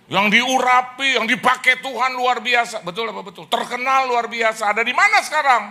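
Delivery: fervent pulpit build to a question; preaching at full force. Acted. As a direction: proclaim it to the congregation. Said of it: "preaching at full force".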